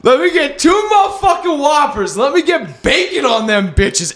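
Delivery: mock shout of a Whopper order; rowdy drunk order. Drunk ordering at drive-thru.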